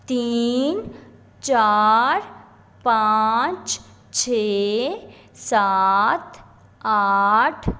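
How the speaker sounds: low, drawn-out numbers, each lifting at the end; teacher's stretched, sing-out number recital; pronunciation drill. Shown as a demonstration. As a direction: count slowly for the class to repeat. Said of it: "پھر سے آپ تلفظ کو پڑھا دیتی ہوں".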